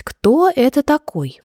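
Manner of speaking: usual speaking voice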